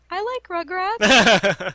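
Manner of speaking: put-on high, loud, drawn-out childish protest; like a five-year-old. Student defending a cartoon in class, like a five-year-old. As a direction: blurt it out like an indignant little kid. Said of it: "I said it like I was a five-year-old".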